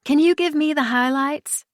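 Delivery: sorry to interrupt, the newbie; apologetic. Acted. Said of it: "in an apologetic tone".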